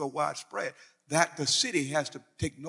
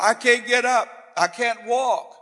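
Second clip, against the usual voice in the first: mock whining sufferer, higher and louder; whiny complaint; 7 semitones higher, 7 dB louder than usual. Preacher mocking a man who won't leave bed, whining. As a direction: act out a moaning man stuck in bed.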